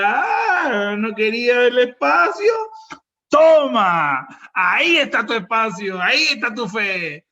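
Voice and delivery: hammed-up mocking boom from on high; God. God taunts the tenant who wanted the space, mocking shout. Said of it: "Yo creo que Dios decía".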